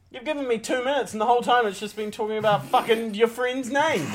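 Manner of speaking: high, loud mock outrage; acted exasperation. Contestant berating panel for wasting time, exasperated. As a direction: rant at the panel, pitch climbing.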